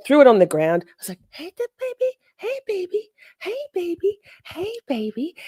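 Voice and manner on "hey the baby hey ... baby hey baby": put-on high, soft baby-talk coo; tiny sing-song voice, much higher; coaxing a puppy. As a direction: gently call a frightened little animal. Retold coaxing of a scared dog.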